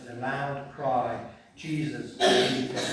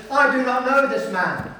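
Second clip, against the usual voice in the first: loud, high, insistent denial; Peter's denial; 8 semitones higher, 7 dB louder than usual. Acted. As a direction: shout it to disown him. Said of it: "your right-hand man Peter has betrayed you".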